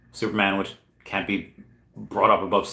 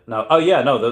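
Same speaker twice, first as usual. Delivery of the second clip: normal talking voice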